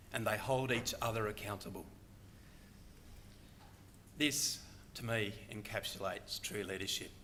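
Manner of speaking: normal talking voice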